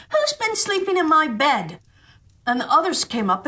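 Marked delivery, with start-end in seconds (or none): 0.1-1.8 higher, lightly voiced dwarf; a higher, startled little dwarf; first dwarf. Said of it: "and he said".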